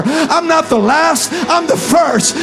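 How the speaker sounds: loud, rising pulpit preaching; full-force preaching. Real, not acted. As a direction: preach it hard and build to a roar. Continued preaching build.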